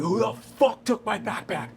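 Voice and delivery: high, drawn-out mock scream, held back in volume; strained, high mock yell; retold scream. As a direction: imitate yourself screaming without really shouting. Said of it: "And I started screaming".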